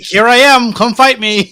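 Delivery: hammed-up invitation to fight; mock challenge. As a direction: call out a dare, half in jest. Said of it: "supposed to be like".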